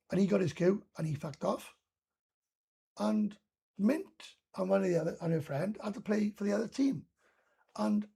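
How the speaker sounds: normal talking voice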